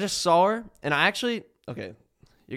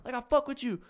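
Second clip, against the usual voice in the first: high mock fan holler, no real volume; put-on high rowdy-fan voice; imitated yelling; 11 semitones higher than usual. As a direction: mimic a pushy fan calling out. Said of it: "yelling at her".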